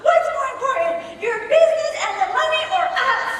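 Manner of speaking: put-on high child's voice, pleading, slowing down; imitating son's question. Son asking mom before she leaves, high child voice. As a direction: play the son guilt-tripping mom. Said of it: "He tells me".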